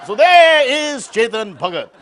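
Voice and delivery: So there is Chetan Bhagat drawn out loud; slow loud introduction. Acted. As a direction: announce "there is" slowly and loudly.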